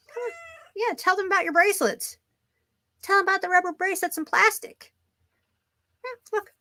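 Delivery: high sing-song voice for the cat; high coaxing pet voice; pet talk. Acted. Talking to cat for viewers.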